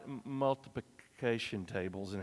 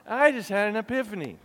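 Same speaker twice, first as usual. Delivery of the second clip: excited; 7 semitones higher, 5 dB louder than usual. Shown as a demonstration.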